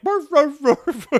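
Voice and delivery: high, loud yapping barks; mock dog barking. Kids barking so the woman will hear, loud mock barks. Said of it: "if we bark like dogs".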